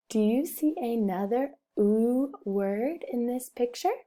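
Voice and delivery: ordinary talk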